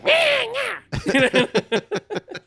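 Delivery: very high, loud playful screech; mock creature squeal. Joking about siccing Stitch on a friend, mock creature squeal. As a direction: squeal loudly like a little attacking creature.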